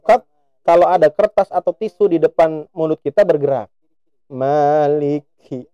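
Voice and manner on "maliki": "Maliki" slow with a puff of air on the kaf; breathy kaf that moves tissue; aspirated kaf. Shown as a demonstration. Teacher shows the correct kaf with a tissue test. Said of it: "Nah, gerak ya".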